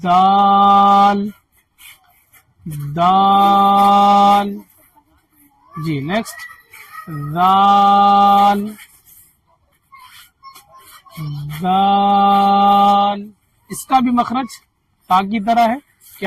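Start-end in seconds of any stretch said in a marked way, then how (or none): 0.0-4.6 "دال" stretched very slowly, tongue tip on the tooth ridge; slow model "دال" for learners; long drawn dal. Shown as a demonstration. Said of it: "اس میں دا بولنا ہے". 7.4-13.3 "دال" stretched very slowly with tongue between teeth; slow model of the dh letter; long drawn dhal. Shown as a demonstration. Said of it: "ذ کی آواز نکالیے".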